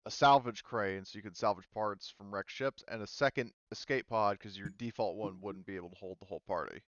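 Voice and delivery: ordinary delivery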